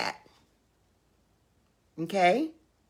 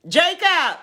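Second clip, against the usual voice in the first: much higher, louder call; holler; 11 semitones higher, 5 dB louder than usual. Real, not acted. Aunt summoning her great-nephew again, hollering. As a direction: holler out the name to summon him. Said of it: "I had to holler at Jacob".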